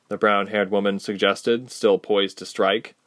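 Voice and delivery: usual speaking voice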